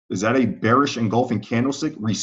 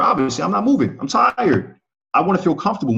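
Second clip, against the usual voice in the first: higher, acting worn out; playful tired voice; 8 semitones higher than usual. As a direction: play the exhausted climber.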